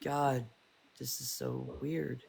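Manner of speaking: soft, slow mutter to herself; quiet muttering. Retold or imitated. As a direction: murmur it, unsettled. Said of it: "you're like".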